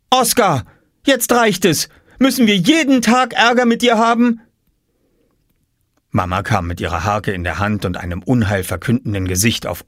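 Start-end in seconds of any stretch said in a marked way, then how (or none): 0.1-4.4 high, loud scolding; high exasperated child's shout; angry shout. Acted. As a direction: yell at the little brother to stop. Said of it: "schrie Jule".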